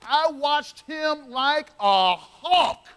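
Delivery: I watched him like a hawk, slowed right down and pressed; slow emphatic image. Acted. Preacher telling the church how John watched Jesus, slow and pressed. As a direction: drag out each word for weight.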